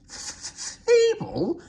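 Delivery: very high, loud outraged squawk; spluttering. Acted. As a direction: sputter in disbelief. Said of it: "spluttered Daisy".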